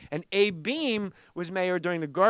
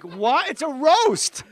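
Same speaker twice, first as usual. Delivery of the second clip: incredulous protest; 8 semitones higher, 5 dB louder, much slower than usual. Retold or imitated. Defending himself to heckling comics.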